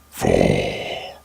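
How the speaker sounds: very low, drawn-out monstrous announcer; spooky countdown voice. Acted. Narrator announces the next creepy story, deep spooky countdown. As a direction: drop deep and stretch the number out.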